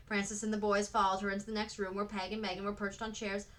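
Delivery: plain reading voice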